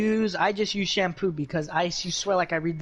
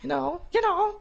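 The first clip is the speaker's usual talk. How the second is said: voice crack; 13 semitones higher than usual. Real, not acted. Teen podcaster stumbling over "you know", voice cracking. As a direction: voice cracking up high. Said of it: "voice crack".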